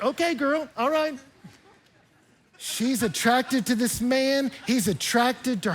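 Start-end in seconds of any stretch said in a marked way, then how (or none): 0.0-1.2 sassy friend. Retold or imitated. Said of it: "and you're like".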